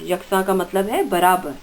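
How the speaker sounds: normal talking voice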